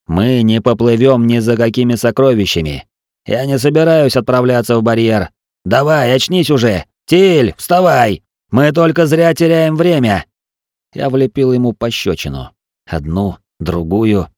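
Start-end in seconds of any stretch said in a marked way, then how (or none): 0.1-10.2 loud urgent shout, higher. Acted. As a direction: yell to rouse him. Narrator shouts to wake Til.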